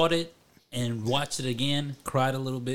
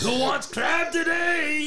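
high, loud drawn-out holler; shouted, stretched-out comic announcement; mock calling out; 13 semitones higher, 5 dB louder, much slower than usual. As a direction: call out dinner like a comic vendor. Mock calling out crab dinner.

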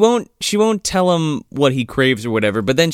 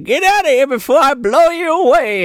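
high granny threat; threatening old lady; 10 semitones higher, 3 dB louder than usual. Retold or imitated. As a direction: threaten him as an old woman. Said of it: "she's like".

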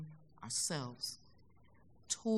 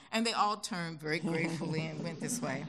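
normal talking voice

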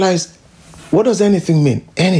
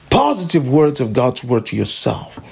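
normal talking voice

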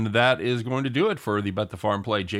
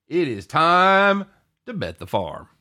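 drawn-out announcer build-up; announcer intro; 5 semitones higher, 4 dB louder, slower than usual. Acted. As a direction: stretch out time, then drop. Segment intro catchphrase.

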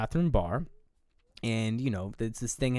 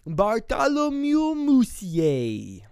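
put-on slow, high, louder old man voice; 10 semitones higher, 5 dB louder, much slower than usual. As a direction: announce the name in a hammed-up old voice. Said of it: "the old man goes".

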